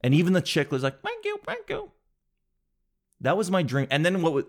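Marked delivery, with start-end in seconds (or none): 1.0-1.9 tiny squeaky voice, much higher and softer; a newborn chick peeping its thanks. Pretend. Comic voicing the rescued chick thanking him in a tiny voice.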